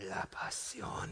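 put-on hushed, foreign-accented whisper; dramatic whisper. Climax of husband's mock movie speech to wife, whispered.

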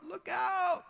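emphatic warning leaping high at the end; urgent repeats climbing to a high-pitched cry; escalating warning. Acted. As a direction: escalate each warning, last one way up in pitch. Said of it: "is sort of escalating. He's emphasizing".

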